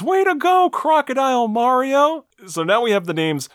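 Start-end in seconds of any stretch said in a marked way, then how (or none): 0.0-2.2 high, loud mock princess cheer; hammed-up high princess exclamation; Peach exclaiming. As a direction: cheer like a squeaky cartoon princess. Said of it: "Princess Peach exclaims".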